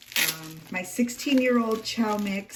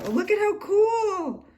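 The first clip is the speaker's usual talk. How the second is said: high, louder gush of delight; thrilled squeal; 13 semitones higher, 5 dB louder than usual. Real, not acted. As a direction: squeal with real excitement. Delight at gift paper.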